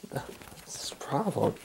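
slow unvoiced whisper. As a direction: breathe it slowly, no voice. Whispered line during scuffle.

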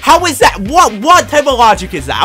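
high, loud incredulous shouting; outraged disbelief. Real, not acted. Gamer furious that the game won't let him dive.

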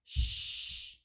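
"Shh" as one long, steady rush of air; long sh sound. Shown as a demonstration. Teacher showing the mouth position, long "Shh". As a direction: draw out "Shh" without voice. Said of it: "I hear the air come long".

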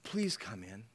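soft, gentle pleading; quiet, sincere entreaty; earnest request. Acted. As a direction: ask him tenderly, leaving room to refuse. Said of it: "in an earnest request to please come in".

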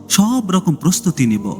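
ordinary delivery

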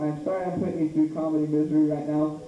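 ordinary talk

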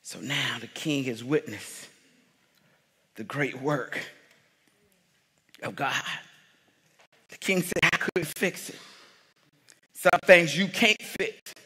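quiet intimate sermon passage; hushed preaching. Acted. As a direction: draw them in quietly.

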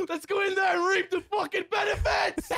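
high, elated yelling; gleeful shout. Real, not acted. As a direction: crow over the win. Celebrating kills, off to loot.

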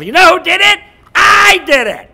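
high, loud proclamation; big acted divine announcement; God claiming credit. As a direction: shout it proudly to the crowd. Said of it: "But when God said".

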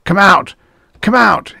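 high, loud challenge; screamed. A narrator's reading. Man threatens the surrounding woods, screaming. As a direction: scream it out at hidden enemies. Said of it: "he screamed".

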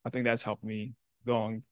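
ordinary delivery

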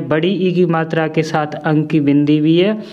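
ordinary talk

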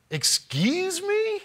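high, drawn-out baffled "Excuse me?"; baffled clerk. Retold or imitated. County clerk on the phone, stunned and baffled. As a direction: sound completely lost by the question.

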